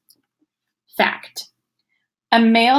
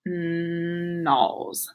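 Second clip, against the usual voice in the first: Nals, stretched out slowly, short o; slow check of the word nals; slow sound-out; 3 semitones lower, 7 dB quieter than usual. Shown as a demonstration. Teacher slowly checks the wrong vowel sound. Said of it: "slow check".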